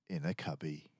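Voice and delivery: usual speaking voice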